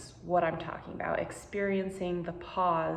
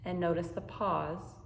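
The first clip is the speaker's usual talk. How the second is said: guided meditation; 5 dB quieter than usual. Acted. Teacher guiding listeners through a pause practice, calm and unhurried. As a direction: ease the listener into calm, one breath at a time.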